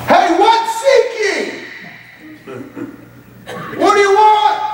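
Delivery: hammed-up harsh demand; harsh shouting Jesus. Shown as a demonstration. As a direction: bark the question like a gruff shouter. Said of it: "But Jesus doesn't speak like this".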